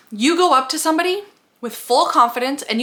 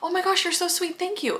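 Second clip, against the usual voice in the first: flattered thanks; 8 semitones higher, 5 dB quieter than usual. Acted. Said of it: "test run, friend thanks her".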